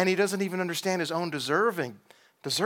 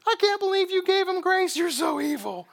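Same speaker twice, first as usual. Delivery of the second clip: mock Jonah's self-pitying prayer in a much higher voice; whiny mocking Jonah; 4 dB louder than usual. Jonah mocked, quoted by God.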